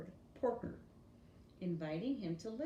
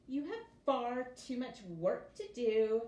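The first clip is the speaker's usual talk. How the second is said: bossy stepsisters; 4 semitones higher, 7 dB louder than usual. Acted. Bossy stepsisters forbid the ball.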